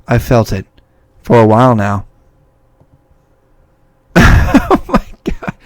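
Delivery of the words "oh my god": high airy laugh into oh my God; disbelieving breathless giggle; breathy laugh. Real, not acted. Reacting to creepy game scene.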